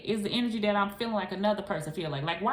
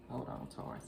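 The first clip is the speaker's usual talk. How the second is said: hushed, breathy hold on; whispered aside; 7 semitones lower, 12 dB quieter than usual. Real, not acted. Pausing the Taurus viewer in a whisper. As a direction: drop to a whisper.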